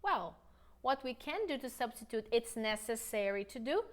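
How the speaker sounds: normal talking voice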